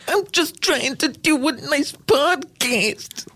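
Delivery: very high, slow falsetto lady; woman voice. Retold or imitated. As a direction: squeak it out as a prim lady. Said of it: "him doing a woman".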